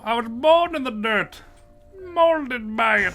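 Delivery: lightly voiced Bane, slow menace; a hint of Bane growl; Bane impression. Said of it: "I used to do a great Bane impression".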